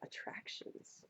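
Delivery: hushed mysterious whisper; whispered title. Acted. Poem reader announcing the next part, whispered. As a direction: breathe the title out.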